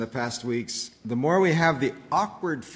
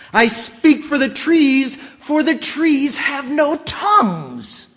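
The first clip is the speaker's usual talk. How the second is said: higher, louder shout with "trees" punched; Lorax shouting; 12 semitones higher, 9 dB louder, slower than usual. Acted. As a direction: yell it, hammering "trees". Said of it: "at the top of my lungs".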